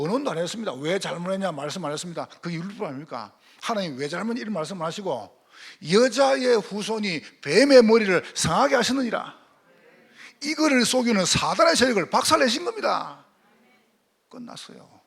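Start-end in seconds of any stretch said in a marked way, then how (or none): 5.9-13.3 preaching at full force. Acted. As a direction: proclaim the victory with full power.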